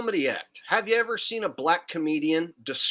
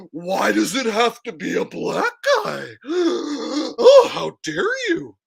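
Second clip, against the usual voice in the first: hammed-up offended gasps, rising high and loud; mock horror; 5 semitones higher, 6 dB louder than usual. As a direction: gasp and protest as if scandalized. Acts out an offended listener.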